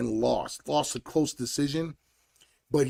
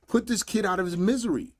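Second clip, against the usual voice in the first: higher and insistent, driving the order home; urgent command; 6 semitones higher, faster than usual. Real, not acted. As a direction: demand it like a corner coach. Frustrated rant at fighter.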